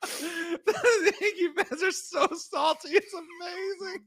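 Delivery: high, slow giggling speech; laughing. Real, not acted. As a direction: laugh through the words. Gloating over rival fans.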